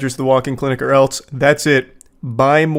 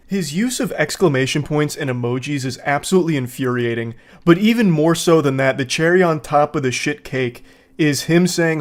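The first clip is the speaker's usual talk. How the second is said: ordinary delivery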